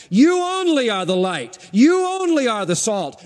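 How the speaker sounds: you pushed up and weighted at the start of each line; stress on you. Shown as a demonstration. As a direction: lean hard on you, then let the line fall. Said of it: "The pronouns are emphatic".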